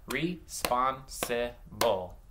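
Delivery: "Responsible" broken into separate beats; "Responsible" slowly segmented for blending; slow syllables. Shown as a demonstration. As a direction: clap out "Responsible" slowly, pausing between syllables. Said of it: "I'm gonna say them slow and clap".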